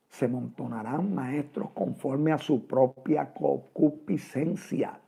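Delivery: solemn scripture quote. Acted. Preacher quotes scripture to congregation.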